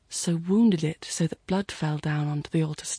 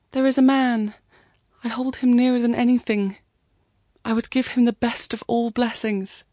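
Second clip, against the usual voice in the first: higher, louder, earnest plea; praying girl, fervent; 5 semitones higher, 5 dB louder than usual. A narrator's reading. Girl answers the voice at the shrine, fervent. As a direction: declare it fervently, pitched up.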